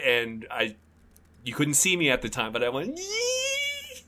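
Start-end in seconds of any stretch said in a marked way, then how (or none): 3.0-4.0 very high, long held squeal; thin sustained squeal of glee; excited squeal. Retold or imitated. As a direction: imitate your own giddy squeal. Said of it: "I was so excited".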